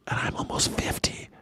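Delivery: breathy under-the-breath confession; whispered aside. Real, not acted. Streamer telling chat his age, dry whispered aside. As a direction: drop to a whisper for the punchline. Aside after exasperated rant.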